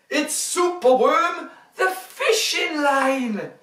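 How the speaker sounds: bright, lively storybook reading; reading to children. A narrator's reading. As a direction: read it big for little ones.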